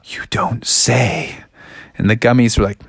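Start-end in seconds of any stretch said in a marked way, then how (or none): 0.0-1.4 slow, stunned stage whisper; hushed shock. Acted. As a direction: whisper it slowly, as if aghast. Froggy reacts to threat.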